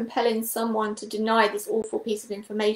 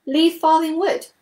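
startled, higher and louder rising query; alarmed question; 6 semitones higher, 5 dB louder than usual. A narrator's reading. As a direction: caught off guard by the idea. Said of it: "queried with some alarm".

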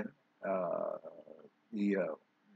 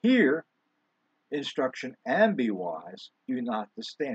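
scripture reading. Real, not acted. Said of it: "where it reads".

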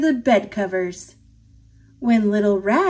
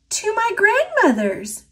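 put-on little girl; 11 semitones higher than usual. A narrator's reading. Little girl answers dragon.

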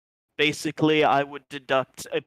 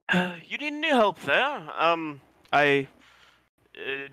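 timid cook voice; 3 semitones higher than usual. Acted. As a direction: offer help nervously. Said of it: "leans his head out of the kitchen and is like".

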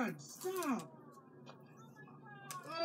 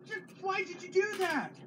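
shocked outcry; 8 semitones higher than usual. Real, not acted. Mom sees son throw pizza.

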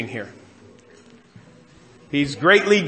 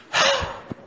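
annoyed sigh; 3 dB quieter than usual. Retold or imitated. Imitating Jesus' annoyed sigh.

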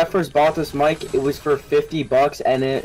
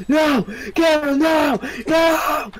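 anguished cry; 14 semitones higher, slower than usual. Real, not acted. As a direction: cry out in despair. Teammate dying, crying out.

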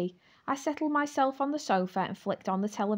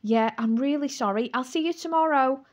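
louder, higher call over the shoulder; calling back; 6 semitones higher, 6 dB louder than usual. A narrator's reading. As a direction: call out a hasty goodbye on the way out. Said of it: "I called back as I bolted".

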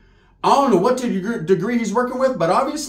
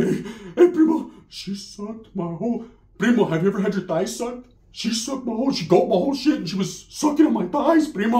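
lightly excited primo; 3 semitones higher than usual. Retold or imitated.

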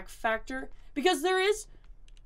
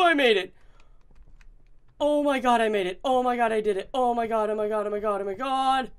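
loud relieved cheering; relieved laughter; 8 dB louder than usual. Real, not acted. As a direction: burst out with relief. Escaped the enemy.

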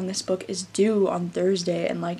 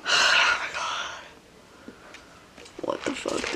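despairing whisper; 5 dB quieter than usual. Real, not acted. Alone, despairing at torn books.

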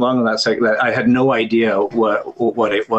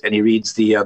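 ordinary delivery

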